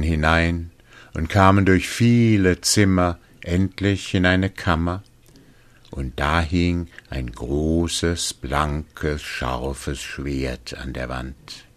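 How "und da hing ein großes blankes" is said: plain reading voice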